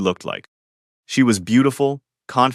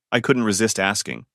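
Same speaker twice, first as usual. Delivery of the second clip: ordinary delivery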